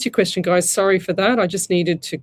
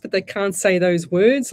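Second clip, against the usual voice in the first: ordinary talk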